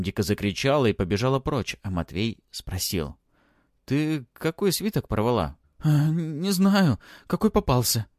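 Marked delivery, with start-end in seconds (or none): 3.8-5.6 higher-voiced urgent question; questioning boy. A narrator's reading. Boy asks the girl about the torn scroll, urgently. 5.8-8.1 high, broken, gasping reply; out of breath. A narrator's reading. Girl answers after a fast run, panting. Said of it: "задыхаясь от быстрого бега, прерывисто ответила Элла".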